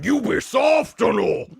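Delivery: loud, slow hammed-up Japanese man; mock manly shout. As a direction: roar it like an action hero. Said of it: "And then you have the men going".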